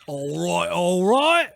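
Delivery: put-on British vowels; mock British accent. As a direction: echo it like a cartoon Brit. Joins the put-on accent game.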